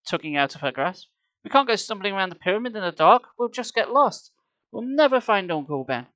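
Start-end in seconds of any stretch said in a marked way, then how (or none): 1.4-6.1 higher, louder insistence; insistent boy. A narrator's reading. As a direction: argue urgently. Insisting to Sari.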